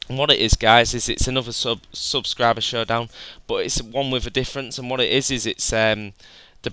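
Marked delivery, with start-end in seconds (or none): none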